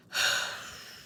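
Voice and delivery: long exasperated sigh; dismayed sigh. Real, not acted. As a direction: let out a heavy breath. Sighs in dismay at remark.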